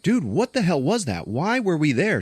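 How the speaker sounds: high-pitched, bewildered questioning; agitated mock demand. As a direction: act out rattled disbelief. Man demanding explanation, acted quarrel.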